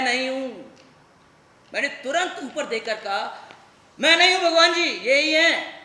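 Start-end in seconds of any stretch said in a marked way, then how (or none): 4.0-5.8 loud, high plea to the heavens; calling up to God. Acted. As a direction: shout upward to clear your name. (ऊपर देखकर भगवान से ज़ोर से सफाई देना)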